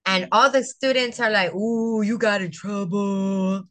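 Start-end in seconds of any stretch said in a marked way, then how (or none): 1.6-3.6 drawn-out singsong taunt; slow, stretched schoolyard tease; teasing classmates. Retold or imitated. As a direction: mimic kids going "ooh" at someone in trouble. Classmates teasing a called-out student.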